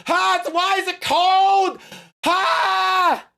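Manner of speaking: startled shouting at a cold bidet blast; shocked yelps. Pretend. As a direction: yelp like an icy jet of water just hit you. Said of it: "you go".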